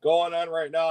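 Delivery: chuckling through the words; amused, laugh slipping out as you talk. Real, not acted. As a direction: break into a laugh mid-sentence. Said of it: "I shouldn't be laughing".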